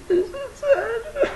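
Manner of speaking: high, slow mock sob with a laugh; fake crying. Vlogger fake-crying over the rain. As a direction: whimper it like a pouting child.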